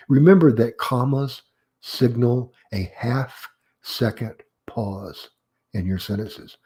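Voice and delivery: usual speaking voice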